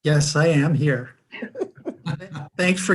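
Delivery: laughing. Real, not acted. Given a second roll call chance.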